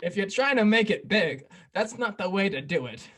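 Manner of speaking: loud mock rebuke to a comedian; mock indignant host. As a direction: scold him like an offended old host. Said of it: "He says".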